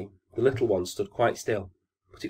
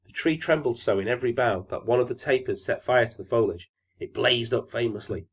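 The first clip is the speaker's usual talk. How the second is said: ordinary delivery, nothing marked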